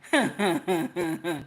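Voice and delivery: real run of short chuckles; amused chuckle. Real, not acted. Host reacting to the clip, amused laugh. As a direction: chuckle along at a funny clip.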